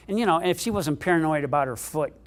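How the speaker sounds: ordinary delivery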